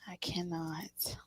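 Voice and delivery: whispered. Real, not acted. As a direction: whisper it to yourself in disbelief. Overwhelmed by the reading.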